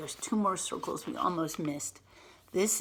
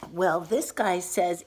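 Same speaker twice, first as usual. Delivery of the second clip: plain reading voice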